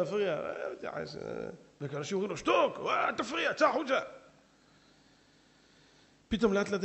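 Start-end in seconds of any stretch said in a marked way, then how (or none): 2.4-4.0 higher, shouted hush; shushing shout. Retold or imitated. Congregants shout at heckler.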